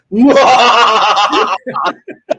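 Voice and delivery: breaking into real laughter at his own voice; laughing at himself. Real, not acted. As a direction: laugh off the silly bit. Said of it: "That's exactly how I do it".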